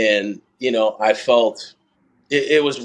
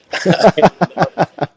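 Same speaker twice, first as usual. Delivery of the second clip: a big, high, loud laugh that bursts out; hearty burst of laughter; 9 semitones higher, 7 dB louder than usual. Real, not acted. Runner laughing at the idea of repeating the race, a loud burst. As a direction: laugh out loud at the thought of doing it again. Laughing at his own remark.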